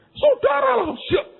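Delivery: high, loud shouted call; sudden sermon shout. Retold or imitated. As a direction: blast it like a speaker turned up. Imitating the sermon on the road. (teriakan khotbah yang tiba-tiba keras)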